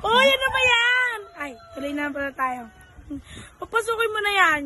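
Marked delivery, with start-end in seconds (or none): 0.1-1.2 high, loud "uy" reproach; loud scolding. Acted. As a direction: call out the rowdy guest. Host reacts to quarrel.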